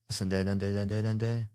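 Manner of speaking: rapid repeated syllables in mimicry; imitating someone. Comedian shows exactly what he did, imitating. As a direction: mimic him with a run of repeated sounds. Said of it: "This is exactly what he did".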